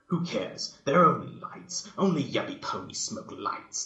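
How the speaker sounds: loud scoff peaking on lights; mock tough yell at yuppie ponies. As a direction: yell it with contempt. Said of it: "Dash yelled".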